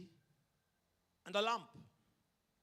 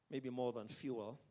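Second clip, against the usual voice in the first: ordinary talk, nothing marked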